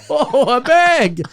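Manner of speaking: stretched, laughing oh a bag; slow laughing mock wonder. Imitating the extra again, slow and laughing. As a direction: drag it out through a laugh. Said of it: "this other person's like".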